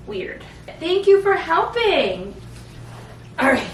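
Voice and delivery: high, sing-song cooing at the babies. Real, not acted. Mother cooing to her baby girls about kisses, high sing-song.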